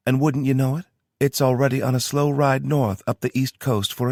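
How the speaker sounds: brisk briefing in a Southern twang; a Southern-twanged logistics officer; Tara's Southern twang. Acted. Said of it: "the twang in her accent".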